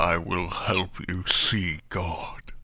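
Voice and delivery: slow, low creepy whisper. Acted. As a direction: breathe it slow and sinister.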